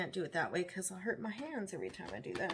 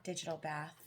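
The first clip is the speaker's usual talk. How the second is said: ordinary talk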